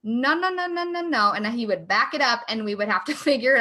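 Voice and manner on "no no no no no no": higher, mock-indignant no's, half joking; indignant outrage. Wife imagining telling Joe off: mock-indignant, higher no's. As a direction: play the outraged wife, mostly for laughs. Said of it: "I would be pissed off. I'd be like".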